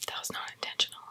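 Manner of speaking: hushed sheepish whisper. Real, not acted. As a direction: mutter it under your breath. Blooper, whispered aside.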